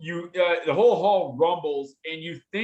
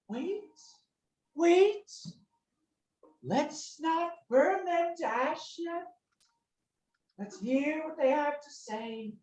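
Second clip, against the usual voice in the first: much higher, slow, soft creature voice; high-pitched dragon voice; 13 semitones higher, 4 dB quieter than usual. Acted. A second dragon urges the first to wait, high-pitched and slow. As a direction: pitch it way up and stretch the words. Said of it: "much higher pitched".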